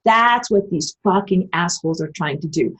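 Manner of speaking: ordinary talk, nothing marked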